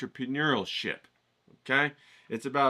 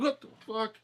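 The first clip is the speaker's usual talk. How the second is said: mock startled; 12 semitones higher than usual. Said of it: "And I'm like".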